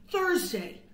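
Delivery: ordinary delivery, nothing marked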